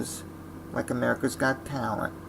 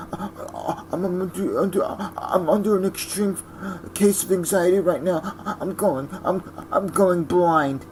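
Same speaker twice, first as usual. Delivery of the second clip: high, halting put-on victim voice; milk the pity like a fake sufferer; whiny sob-story contestant; 5 semitones higher than usual.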